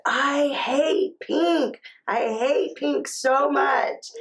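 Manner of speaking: put-on high, drawn-out child's protest; retold toddler meltdown. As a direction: imitate a toddler wailing slowly, without shouting. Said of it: "He was screaming and crying".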